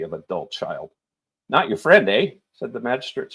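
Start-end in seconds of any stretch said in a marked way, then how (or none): 1.5-2.3 louder, higher teasing retort; sparkling. A narrator's reading. Magistrate mocks the prisoner's answer, sparkling and loud. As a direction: toss it back with playful glee.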